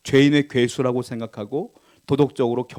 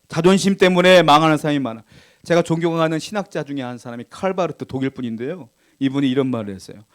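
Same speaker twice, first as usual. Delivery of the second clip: ordinary delivery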